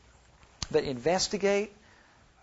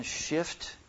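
ordinary talk, nothing marked

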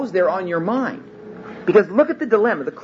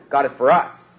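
us stressed with a rise. Shown as a demonstration. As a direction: end leaning on us. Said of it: "putting the emphasis on four different words".

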